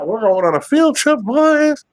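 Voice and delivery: high, lively teacher voice announcing; teacher announcing a field trip. Pretend. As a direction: call out like a tour guide.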